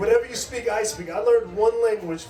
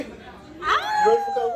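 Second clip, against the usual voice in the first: hype question. Real, not acted. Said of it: "hype the crowd".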